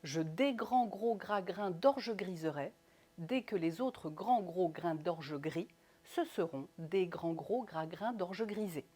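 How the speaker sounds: slow, articulated tongue twister falling on "grisé"; measured statement, each consonant clear, settling on "grisé"; answering intonation. Shown as a demonstration. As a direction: answer deliberately, closing downward on "grisé". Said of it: "J'y réponds".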